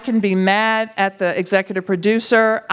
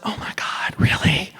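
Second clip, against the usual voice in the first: breathy hushed disbelief, then voice comes back; stunned whispered oh my God; awed whisper; 5 dB quieter than usual. Retold or imitated. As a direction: whisper it like you can't believe it.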